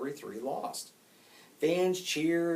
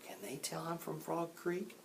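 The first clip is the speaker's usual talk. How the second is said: whispered worry to oneself; barely voiced, inward question; private thought; 4 semitones higher, 13 dB quieter than usual. A narrator's reading. Jack wonders to himself about the hecklers, whispering. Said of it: "thought Jack".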